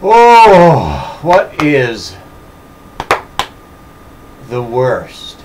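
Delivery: lazy drawn-out sarcasm; sarcastic. Acted. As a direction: lean back and drip sarcasm. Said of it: "my maximum sarcastic recline".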